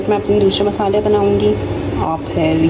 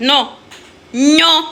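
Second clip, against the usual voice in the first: normal talking voice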